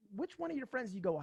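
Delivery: ordinary delivery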